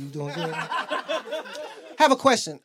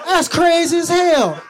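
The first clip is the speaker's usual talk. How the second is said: loud, high, drawn-out exclamation; amazed outburst; 10 semitones higher, 8 dB louder, slower than usual. Acted. Watching his buddy on TV during sex, loudly amazed.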